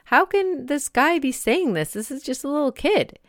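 high, incredulous gasp of a question; stunned parent watching the coach; shocked disbelief. Retold or imitated. Parent shocked at the coach berating a child, disbelief. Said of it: "you're looking around thinking".

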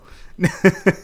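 loud high laugh. Real, not acted. Laughing again.

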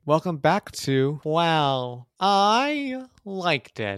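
slow, drawn-out know-it-all intro; nerdy professor voice. Pretend. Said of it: "who are you? ... professor".

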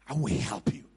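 very low, hushed assurance; solemn low promise. Acted. Preacher voicing a promise of help, solemn and low. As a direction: drop the voice deep and promise it gravely.

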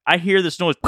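usual speaking voice

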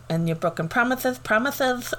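lisped "promises", the s sounds turned to th; singer's lisp. Retold or imitated. Quoting a lisping singer's song to a friend, "promises" lisped with th. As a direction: imitate a lisp, "promises" with th for each s. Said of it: "The singer has a lisp".

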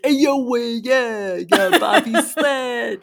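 loud, high hammed-up New York accent; bad New York accent. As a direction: imitate a bad New York Italian drawl. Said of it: "terrible at doing New York accents".

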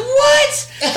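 loud, high disbelieving shriek; retold yelp of disbelief; shocked reaction. Mom reacting to her son's cussing, shocked shriek. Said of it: "And I was like".

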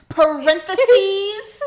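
high laughing triumph. Real, not acted. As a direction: cackle with glee at winning. Laughing at being proven right.

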